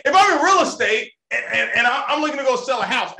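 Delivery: ordinary delivery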